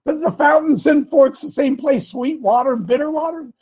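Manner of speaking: high, animated rhetorical question and answer; preaching scripture. Acted. Preacher asking listeners about the fountain in James, animated. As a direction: put the question high, then answer it emphatically.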